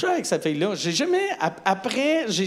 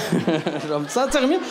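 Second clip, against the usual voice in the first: laughing; 2 semitones lower than usual. Acted. Laughing through line.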